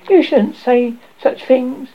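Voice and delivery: high, loud, slow rebuke; retort. A narrator's reading. Peter scolding the fairy, sharp retort. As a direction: retort with drawn-out indignation. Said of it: "Peter retorted".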